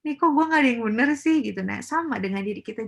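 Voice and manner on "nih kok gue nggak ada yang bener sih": annoyed complaint. Retold or imitated. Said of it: "pasti kan kesel ya".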